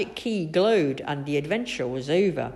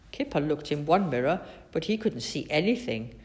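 usual speaking voice

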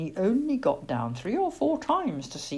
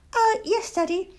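high, hesitant little voice; small, cowed owlet; meek owlet. A narrator's reading. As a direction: back down nervously in a child's high voice. Owlet backing away from cross father.

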